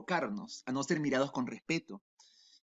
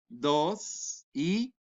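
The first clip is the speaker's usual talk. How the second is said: slow count; much slower than usual. Shown as a demonstration. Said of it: "A la cuenta de tres".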